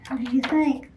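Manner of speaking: ordinary delivery, nothing marked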